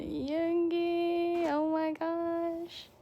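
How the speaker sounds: high, slow squealing reveal; high drawn-out fangirl squeal; delighted squeal. Real, not acted. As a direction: stretch the name out in delight. Revealing bias photocard.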